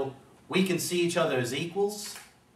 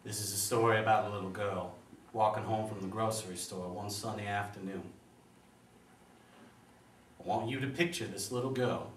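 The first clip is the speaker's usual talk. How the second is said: soft, measured courtroom storytelling; hushed storytelling; 3 semitones lower, 6 dB quieter than usual. Acted. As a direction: ease softly into a grave story, pausing between phrases. Lawyer begins grim story to jury.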